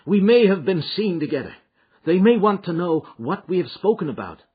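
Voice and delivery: voiced Morris. Morris speaks in the story.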